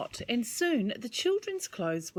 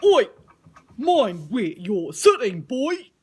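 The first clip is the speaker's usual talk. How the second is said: louder, cross little voice from below; grumpy worm; 2 semitones higher, 10 dB louder than usual. Acted. Worm scolding the boy sitting on him, louder and cross. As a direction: tell him off from under his bottom. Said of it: "said a voice from underneath Tim".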